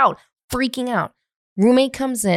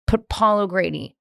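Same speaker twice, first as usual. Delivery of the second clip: ordinary delivery, nothing marked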